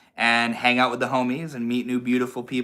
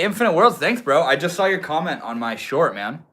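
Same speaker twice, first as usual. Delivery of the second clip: normal talking voice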